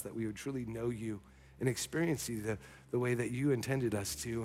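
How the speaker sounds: soft, hushed closing prayer. Acted. Pastor praying softly over the congregation. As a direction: pray gently over the congregation.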